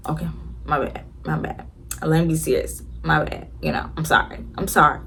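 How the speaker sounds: soft, subdued mock apology; meek apology. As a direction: back down quietly, as if chastened. Acted meek apology after scolding.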